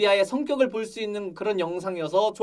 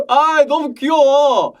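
loud, higher, drawn-out squeal of delight; gushing; 4 semitones higher, 7 dB louder, slower than usual. Real, not acted. Fan reacting to a cute character, gushing loudly. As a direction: gush loudly, stretching the words out.

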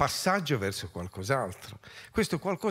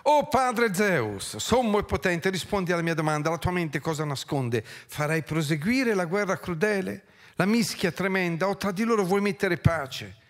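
theatrical poetry recital, raised pitch; declaimed plea; 5 semitones higher, 3 dB louder than usual. Acted. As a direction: declaim it as a solemn plea to a god. Declaims epic plea to Zeus.

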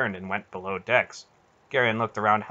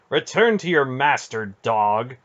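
raised, louder scathing dismissal; 4 semitones higher, 6 dB louder than usual. A narrator's reading. Aunt sending the Grollam back to his master, scathing. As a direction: send him off with scorn. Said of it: "Aunt Paul said scathingly".

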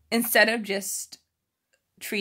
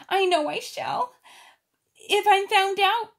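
put-on high, louder girlish voice with pink teary eyes; much higher childlike voice, fretful; tearful Ermengarde; 12 semitones higher, 5 dB louder than usual. A narrator's reading. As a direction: play a little girl who has been crying. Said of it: "she said".

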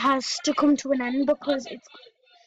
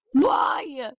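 frustrated cry. Real, not acted. Fed up with her laptop, yelling why.

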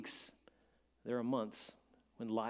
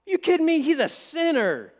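high, loud incredulous crowd; scoffing crowd; 12 semitones higher, 11 dB louder than usual. Retold or imitated. Crowd looking around at Jesus, scoffing high and loud. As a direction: sneer it out as the shocked crowd. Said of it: "They're looking around going".

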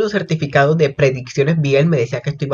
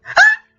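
very high, loud shriek of joy; delighted shriek; 5 dB louder than usual. Real, not acted. Fan shrieking while others sleep, very high shriek. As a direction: shriek at the screen. Shriek then says can't scream.

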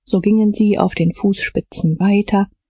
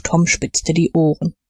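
plain reading voice

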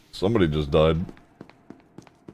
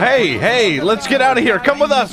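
urgent calling out. Acted. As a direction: shout them along to follow. Urging prisoners to flee.

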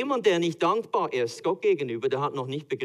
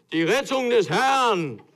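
slow, much higher and louder, laughing through it; grand proclamation; 8 semitones higher, 8 dB louder, slower than usual. Acted. Moses before the Red Sea, hammed-up grand proclamation.

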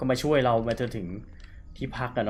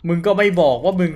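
loud exasperated telling-off, higher; startled scolding; 6 semitones higher, 10 dB louder than usual. Real, not acted. Gamer scolding zombie NPC for the scare, loud and exasperated. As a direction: snap at him for not warning you.

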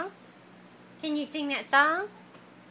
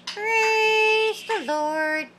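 slow drawn-out praise; 6 semitones higher, much slower than usual. Shown as a demonstration. Teaching the bird a phrase.

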